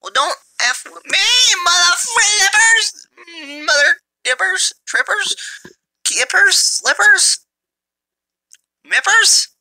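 very high, stretched cartoon taunting; sing-song nonsense insults at the enemy; silly mock insults. Gamer riffing goofy insults at the enemy team in a squeaky cartoon voice.

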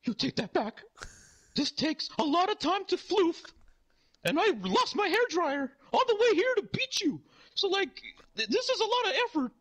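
hurt, indignant clown in high falsetto; Ronald McDonald. Retold or imitated. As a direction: climb from offended to hammed-up shrill outrage. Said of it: "and he's like".

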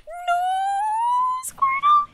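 very high, drawn-out cry; high-pitched wail. Real, not acted. Gamer losing Squirtle in a match, high-pitched wail.

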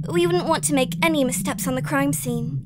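normal talking voice

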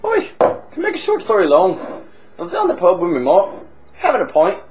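boozy Irish pub regular, chatty and cheeky; hammed-up Irish drunk telling a tale; stage Irish accent. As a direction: lay on a thick comic Irish brogue. Held Irish barfly voice.

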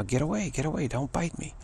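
high, firm "get away"; mock shooing. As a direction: drive the dog off.

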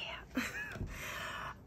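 squeaky high-pitched giggle. Real, not acted. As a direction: let out a tiny squeal of a laugh. Laughs after greeting.

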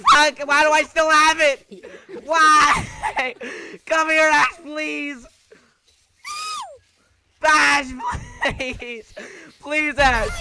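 loud, high cries of mock panic; hammed-up frantic plea; panicked pleading. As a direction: beg loudly for help with rising desperation. Stuck with bomb, begging Ash.

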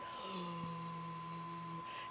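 long, soft drawn-in gasp; mock a dying woman's drawn-out gasp; imitated gasp.

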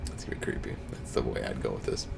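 low, unvoiced spooky hush; hint at the twist in a hush; creepy whisper. Real, not acted. Whispering to friends how the story turns creepy.

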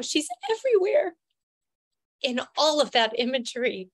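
lump in throat. Real, not acted. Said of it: "feel that lump in my throat again now".

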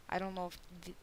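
ordinary talk

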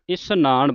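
ਇਸ਼ਨਾਨ with a plain s, 'isnaan'; correct pronunciation. Shown as a demonstration. Teacher shows the congregation how to recite, ਇਸ਼ਨਾਨ with plain s. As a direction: say ਇਸ਼ਨਾਨ with s, not sh. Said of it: "ਇਸ਼ਨਾਨ ਬੋਲਣਾ ਹੈ".

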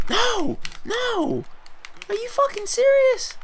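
squealed high in outraged disbelief; shrieking disbelief. Real, not acted. Gamer losing a fight, shrieking high in disbelief. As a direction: shriek it like you can't believe it. Getting beaten in fight game.

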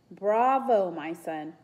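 loud bravo, amused, no real laugh; mock-applaud him, then drop away; chuckling. A narrator's reading. Villain amused by the boy's bravery, mildly chuckling. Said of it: "Lukos chuckled".

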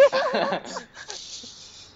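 a burst of high-pitched laughter; real laugh, shooting up high. Real, not acted. Friend's slide tackle mocked.

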